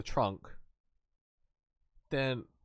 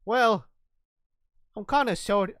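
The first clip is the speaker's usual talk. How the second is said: loud, high hammed-up drawl; mock client voice; 8 semitones higher, 7 dB louder, slower than usual. Client explaining why he threw money, mock high voice. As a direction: play a hapless rich client. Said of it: "he was just like".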